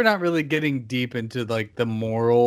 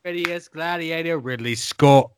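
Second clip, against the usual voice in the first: put-on Cockney accent; 3 semitones higher than usual. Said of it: "Cockney accent".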